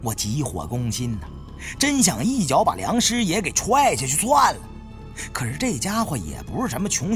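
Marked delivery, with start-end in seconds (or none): none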